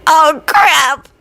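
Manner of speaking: high raspy choke; strained, raspy exclamation; rasped. A narrator's reading. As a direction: rasp it out after choking. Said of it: "coughed on the big mouthful and rasped".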